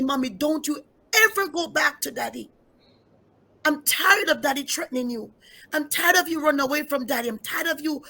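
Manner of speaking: put-on a little girl insisting her father won't change; voicing her young daughter. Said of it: "When that baby said to me".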